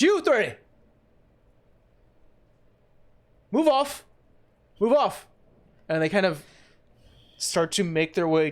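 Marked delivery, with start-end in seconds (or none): 0.0-5.3 high, loud barked order; commanding shout. Acted. Guide yells at the three thugs, commanding shout. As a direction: shout them off like a guard.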